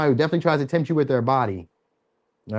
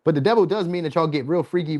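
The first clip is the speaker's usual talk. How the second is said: normal talking voice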